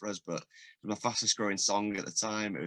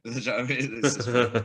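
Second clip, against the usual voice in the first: raised voice through a laugh; laughing while talking; 4 semitones higher, 8 dB louder than usual. Real, not acted. Guest amused by constant small-town greetings, laughing. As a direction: let real laughter carry the words.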